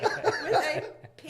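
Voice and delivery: P laughed out; laughing. Real, not acted. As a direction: laugh through the words. Laughing over band name.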